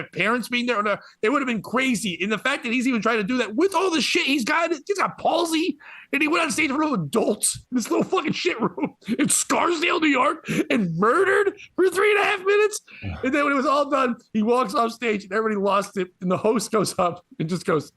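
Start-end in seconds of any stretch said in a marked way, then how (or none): 6.1-12.8 impassioned. Real, not acted. Building passionate praise.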